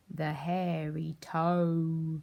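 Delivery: very slow, ominous title; drawn-out title. Acted. Teacher announcing the book, slow ominous title. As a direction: stretch the title out spookily.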